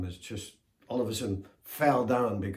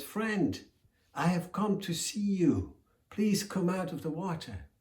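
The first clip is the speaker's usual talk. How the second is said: much higher, louder friendly call; tortoise voice; 9 semitones higher, 4 dB louder than usual. Acted. Tortoise calls the hippo out of the water, high. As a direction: coax him out sweetly, pitched high.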